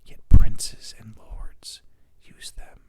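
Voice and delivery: intimate whispered reading; ASMR whisper. Shown as a demonstration. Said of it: "doing the A S M R thing".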